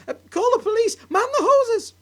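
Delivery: high, loud frantic cry for help; panicked shout. Acted. Wife panics.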